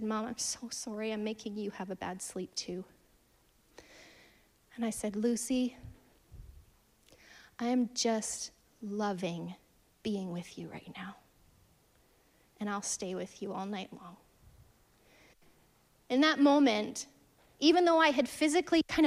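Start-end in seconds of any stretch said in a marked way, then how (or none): 0.0-2.9 a hint of a sad, sorry child, much softer; sad little girl. Mother retells her anxious daughter's apology, softly sad. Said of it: "And she got so sad". 5.2-14.2 soft reassurance. Retold or imitated. Mother comforting her sleepless daughter in bed, softly whispered.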